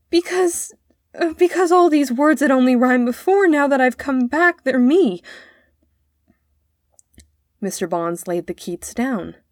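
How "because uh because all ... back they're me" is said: put-on high, upset child's outburst; tearful. A narrator's reading. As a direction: blurt it out through acted tears, voice raised. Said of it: "burst into tears".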